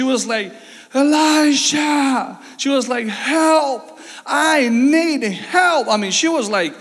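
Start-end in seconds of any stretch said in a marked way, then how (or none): 0.9-2.4 high, drawn-out desperate cry; screaming a name. Retold or imitated. Widow crying out to the prophet, desperate high call. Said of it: "she was screaming". 3.1-5.9 high, slow, pleading shout; desperate cry for help. Retold or imitated. Widow screaming to Elisha, drawn-out high plea. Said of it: "she was screaming".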